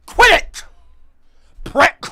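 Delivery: high, very loud yelled insults; furious yelling. Real, not acted. Streamer insulting his chat, furious yelling. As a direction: spit each insult out at the top of your voice.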